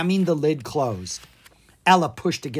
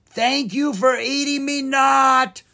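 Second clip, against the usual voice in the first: muffled ogre voice; 11 semitones higher, 6 dB louder, slower than usual. Acted. Ogre calls from trunk.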